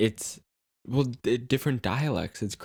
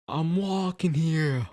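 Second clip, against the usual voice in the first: put-on drawn-out, higher-pitched East Coast accent; mock Jersey accent; 6 semitones higher, slower than usual. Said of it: "those accents are so".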